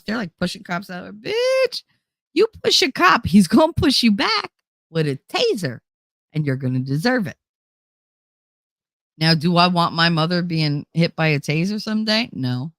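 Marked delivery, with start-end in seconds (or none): 1.2-7.4 high, sassy telling-off; mock retort. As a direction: snap back at her, pitch way up. Speaker retorts to the Karen.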